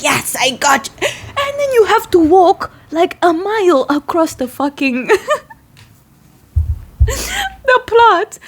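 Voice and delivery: high, laughing and half-crying through it; giggling through the story. Real, not acted. Laughing while retelling weed pulling.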